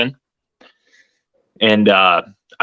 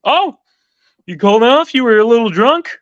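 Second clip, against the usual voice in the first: much higher mock boss voice; imitating the boss; 13 semitones higher, 4 dB louder than usual. Salesman imitating his general sales manager, high mock voice. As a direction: play the boss as a squeaky, amused pushover. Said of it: "Brett just looks at me and he goes".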